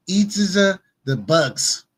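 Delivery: very slow, drawn-out mock German accent; mocking Klaus Schwab in a slow German accent. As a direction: drag each word out like a cartoon German villain. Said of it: "I don't know if that's a German accent".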